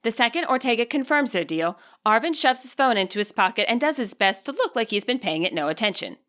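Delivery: usual speaking voice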